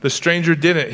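normal talking voice

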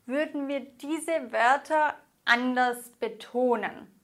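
ordinary talk, nothing marked

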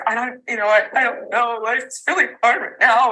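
retold crying. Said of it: "stumbling and crying".

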